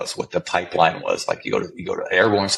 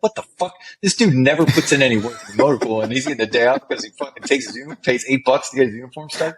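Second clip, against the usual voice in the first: high-pitched exasperated griping; retold indignant rant, pitched up; frustrated; 6 semitones higher than usual. Soldier griping about the guy given the day off, exasperated. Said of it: "I was getting kind of frustrated".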